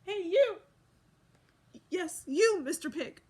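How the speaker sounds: loud, high call across the room. A narrator's reading. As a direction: shout to get his attention. Toothbrush calls out to pig.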